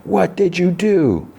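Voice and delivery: slow, high, drawn-out wail of accusation; hammed-up yell, stretching every word up high; mock yell. Marcy yelling at Joe as the alien watches, drawn-out mock yell. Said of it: "looks at Joe and yells".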